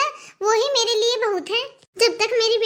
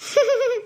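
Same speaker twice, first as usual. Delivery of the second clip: short pleased giggle; a brief acted chuckle; 3 semitones higher than usual. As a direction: giggle lightly at his request. Wife pleased by husband's request.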